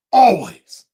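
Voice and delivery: "always" suddenly blurted loud; mock emphatic. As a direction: punch out "always" in a mock pledge. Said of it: "I can see the pain in your eyes".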